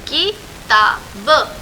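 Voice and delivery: किताब stretched very slowly, syllable by syllable, rising at the end; sounded out slowly. Shown as a demonstration. Teacher to children, spelling किताब by drawing it out slowly.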